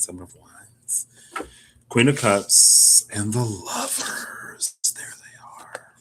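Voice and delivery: slow hushed whisper. Real, not acted. Whispering the cards she just drew to viewers. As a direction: breathe the card names in awe.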